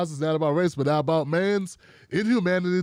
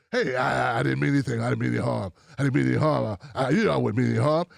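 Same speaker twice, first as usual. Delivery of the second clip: lower, sheepish mock apology; 8 semitones lower, faster than usual. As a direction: pretend to plead innocence, fumbling. Whoopi's mock apology voiced.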